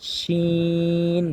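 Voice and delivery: শিন held long from mid-tongue; letter demo. Shown as a demonstration. Teacher demonstrating শিন again, drawn out. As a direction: stretch শিন slowly. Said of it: "তালুর সঙ্গে লাগিয়ে".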